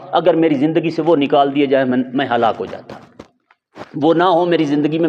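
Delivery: usual speaking voice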